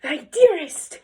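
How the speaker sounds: high, loud, dramatic concern for a dearest wife; hammed-up worried husband; alarmed husband. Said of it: "her husband's like".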